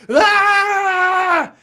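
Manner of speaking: loud, high wordless scream; child's frustrated scream. Pretend. Imitating a stressed kid to parents, a loud high scream. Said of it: "they will be able to go".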